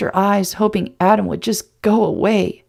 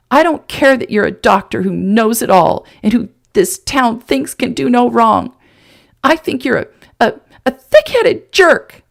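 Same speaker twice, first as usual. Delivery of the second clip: higher, louder furious tirade; 5 semitones higher, 5 dB louder than usual. Acted. Woman raging at the man, ending in an insult. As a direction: build to the insult in a rage. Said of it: "her tirade".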